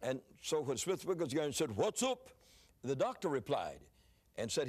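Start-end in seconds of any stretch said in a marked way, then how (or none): 1.8-2.3 gruff English dialect question, pitched high; high, punchy dialect call. Retold or imitated. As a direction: imitate a blunt Englishman's "hootsup". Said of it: "just a little bit gruff".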